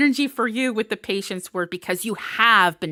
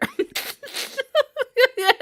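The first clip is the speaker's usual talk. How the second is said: high bright giggle. Real, not acted. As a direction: giggle at the joke. Laugh about comfy spot.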